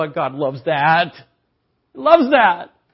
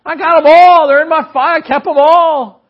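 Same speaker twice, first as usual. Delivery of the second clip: proud exclamation; 10 semitones higher, 13 dB louder than usual. Real, not acted. Proud parent about drawings.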